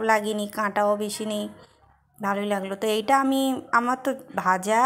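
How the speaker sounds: chewing while talking; speaking around food; mouth full. Real, not acted. Seen 3: bites rice at 2.5, chews while speaking remaining words.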